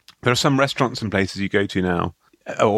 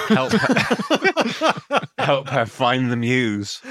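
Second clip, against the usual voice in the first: laughing; 5 semitones higher, much slower than usual. Real, not acted. Cracking up at own joke.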